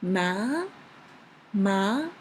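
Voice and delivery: "Ma" starting mid and rising to high. Shown as a demonstration. Teacher demonstrating second tone for learners, rising "Ma". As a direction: lift "Ma" steadily up to the top. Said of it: "is from the mid to high".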